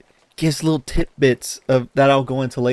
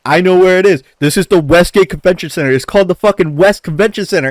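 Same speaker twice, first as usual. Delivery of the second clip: mocking uncle's insistence; 5 semitones higher, 5 dB louder, faster than usual. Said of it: "I didn't say it".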